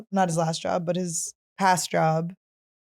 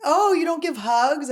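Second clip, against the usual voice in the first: higher, drawn-out challenge to a stranger; mock yell; 7 semitones higher than usual. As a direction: call it out like a girl yelling at someone. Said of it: "yelling at Todd".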